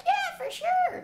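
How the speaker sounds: very high, breezy lady voice; lady agreeing. Retold or imitated. Ginger lady promising visits, high and breezy. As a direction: toss it off lightly, high and sweet. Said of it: "And she was like".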